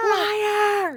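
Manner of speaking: high mock scream. Imitating Khloe yelling into the phone, high mock scream. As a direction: shriek it like a betrayed reality star. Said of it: "she, like, screams".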